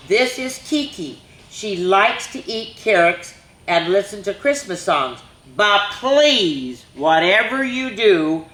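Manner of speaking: put-on lively storybook reading for little kids; reading to children. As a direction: read it like story time at the library. Reads picture book to children.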